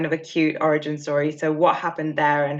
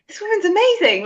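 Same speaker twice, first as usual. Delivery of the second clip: amazed gushing; 4 dB louder than usual. Retold or imitated. Host gushing in admiration.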